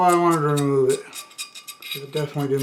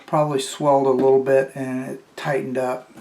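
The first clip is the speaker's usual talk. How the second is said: usual speaking voice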